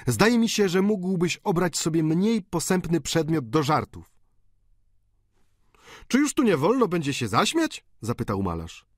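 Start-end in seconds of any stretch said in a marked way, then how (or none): 0.1-4.2 put-on high, reproving young man; Eugene reproachful. A narrator's reading. Eugene rebuking the painter for his jokes, a hint of reproach. 6.1-7.8 high, mock-injured question; painter protesting. A narrator's reading. Painter protesting to Eugene, lightly mocking.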